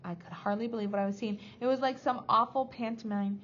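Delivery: ordinary delivery, nothing marked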